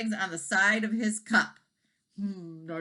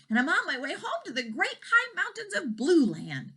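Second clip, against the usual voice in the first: higher small dragon voice; baby dragon; 11 semitones higher than usual. A narrator's reading. Baby dragon telling cows, higher small voice. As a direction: make it a little dragon.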